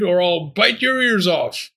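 high-pitched screamed threat. A narrator's reading. Talking bone threatening the fox for Pearl, screaming. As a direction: threaten in a high, forceful cry. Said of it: "the bone screamed".

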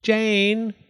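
mother calling her daughter from another room. A narrator's reading. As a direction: shout her name across the house. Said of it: "A woman's voice came from another room".

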